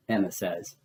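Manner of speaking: ordinary delivery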